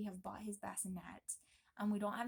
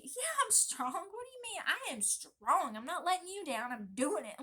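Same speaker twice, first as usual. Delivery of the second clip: much higher and louder, psyched up; self-hype; 8 semitones higher, 6 dB louder than usual. Acted. Wife psyching herself up for labor, high and loud. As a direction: hype yourself into it.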